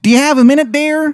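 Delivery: put-on very high, goofy Chicago accent; badly faked Chicago accent, high-pitched; terrible Chicago accent. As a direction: ask it as a wizard faking Chicago badly, voice way up. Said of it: "a terrible accent".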